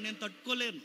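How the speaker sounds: ordinary talk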